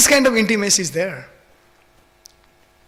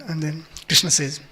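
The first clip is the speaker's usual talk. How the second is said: ordinary talk, nothing marked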